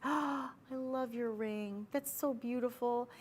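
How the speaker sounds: soft, high, drawn-out admiration; admiring gasp voice. Acted. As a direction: coo over the ring, soft and slow. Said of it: "you say it in that voice".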